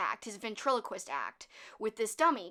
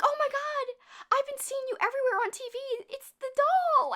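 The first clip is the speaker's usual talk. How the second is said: much higher, squealing mock gush; gushing fan; 14 semitones higher, 3 dB louder than usual. Woman gushing at the ventriloquist on her doorstep, high mock squeal. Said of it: "she's like".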